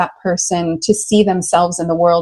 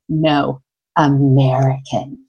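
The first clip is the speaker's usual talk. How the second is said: low, slow, scornful "American"; disdainful; 6 semitones lower, slower than usual. Retold or imitated. Salon woman scoffing to her neighbour about the American, disdainful. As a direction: drop the word with deep disdain. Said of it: "Like, with the most deep disdain".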